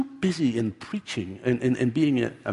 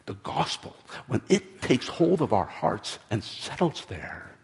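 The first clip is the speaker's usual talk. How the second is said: ordinary talk, nothing marked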